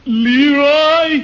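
loud high call up the stairs. Acted. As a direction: bellow his name up the stairs. Calling up the stairs.

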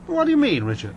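high, indignant question dropping on the name; surprised. Acted. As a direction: act taken aback by the accusation. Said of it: "don't sound so surprised".

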